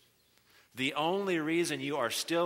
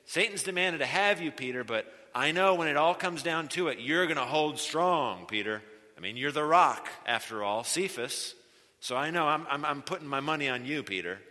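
much louder, hearty pep-talk voice; falsely confident Jesus; 6 dB louder than usual. Pretend. Mock Jesus cheering Peter on, loud and over-confident. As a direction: ham up a cheerleader's blind confidence. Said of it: "He doesn't say".

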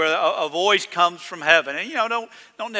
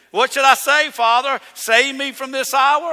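"Save me from this hour" pitched high and rising; "hour" lifted at the end, not expecting an answer; rhetorical question; 7 semitones higher, 5 dB louder than usual. Acted. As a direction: ask "What should I say" high and louder, as a rhetorical question. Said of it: "It's a rhetorical question".

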